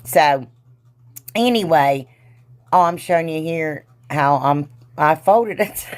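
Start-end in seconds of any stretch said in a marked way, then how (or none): none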